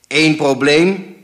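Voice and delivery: slow, very loud indignant echo; too-loud grumble. A narrator's reading. Father butting into mother's phone call, too-loud grumble. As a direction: grumble it out far too loudly. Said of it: "bromde mijn vader, iets te hard".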